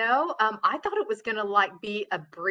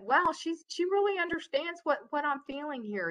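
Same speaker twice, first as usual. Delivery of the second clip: higher-pitched imitation of an understood customer; relieved customer voice; 7 semitones higher than usual. Customer realizing the agent understands, in a higher imitated voice. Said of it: "then they'll know that".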